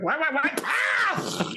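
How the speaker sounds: high whining goblin wail ending in a yelp; whiny goblin. Acted. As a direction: wail as the blast hits. Goblin hit by the blast.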